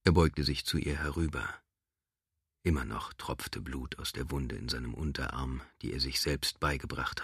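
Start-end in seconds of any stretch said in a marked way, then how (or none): none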